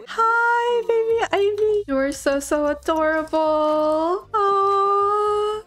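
high, drawn-out coo; high sing-song adoring greeting; baby-talk cooing. Pretend.

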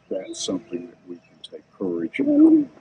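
ordinary talk

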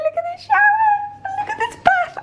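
very high thrilled squeal; real giddy excitement; excited squeal. Real, not acted. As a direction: squeak with delight at the bath. Squealing over bathroom.

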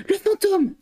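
high startled shriek of fright; frightened cry. A narrator's reading. Housekeeper startled by a clap, crying out in fright. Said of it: "un cri de surprise et de frayeur".

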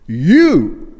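"You" loud, high and drawn out; stress on You. Shown as a demonstration. Preacher showing the Greek emphasis, "You" pushed loud and high. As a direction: hit "You" hard, rising then falling. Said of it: "emphasized in the Greek".

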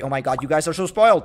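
normal talking voice

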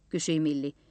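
plain reading voice

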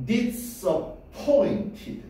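disappointed를 아주 천천히 음절마다 끊어서; disappointed의 point를 가장 강하게, 높고 느리게. Shown as a demonstration. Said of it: "제일 강하게 발음할 거". (disappointed, 또박또박 길게 늘여서)

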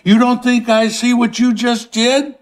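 high, indignant ranting; furious tirade. Acted. Howard berates those he shelters for ingratitude, furious tirade. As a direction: build from indignation into rage.

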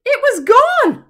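high, loud, slow cry of dismay; spring the shock on the listeners; shocked reveal. A narrator's reading. Suspense reveal after long pause.

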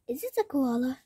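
ordinary talk, nothing marked

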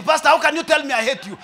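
mock outraged reply; indignant girl. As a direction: play the offended girl. Girl retorting to pastor.

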